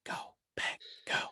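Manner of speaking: whispered team cheer; whispering. Real, not acted. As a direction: breathe it like a secret cheer. Fan cheer under breath.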